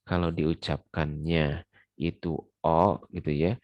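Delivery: normal talking voice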